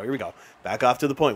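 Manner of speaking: ordinary talk, nothing marked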